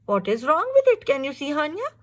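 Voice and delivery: usual speaking voice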